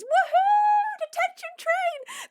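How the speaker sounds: very high, loud woohoo then drawn-out shout; hammed-up glee; mock whooping cheer. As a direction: whoop like a kid on a ride. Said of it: "Matt M thirty-three says".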